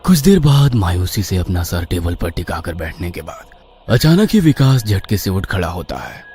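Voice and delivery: ordinary delivery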